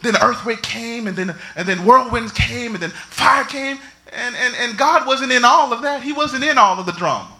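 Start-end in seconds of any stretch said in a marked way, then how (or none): none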